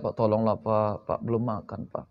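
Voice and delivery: soft, begging whine to a passer-by; pleading beggar. Retold or imitated. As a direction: plead like a hungry beggar asking for alms. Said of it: "Macam mana kira-kira? Minta sedekah".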